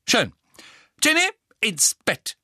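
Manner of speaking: high, loud maternal command; called-out order. A narrator's reading. Mother ordering her daughter to bed, loud high call. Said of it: "rief Misses Weasley".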